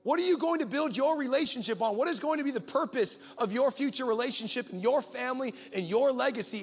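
high, loud, urgent altar call; full-force preaching. Acted. Pastor challenging single men and ladies, preaching hard. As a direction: press the congregation to decide.

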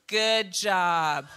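low, slow, unimpressed "good job"; flat fake praise. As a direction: praise with no feeling at all. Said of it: "they're like".

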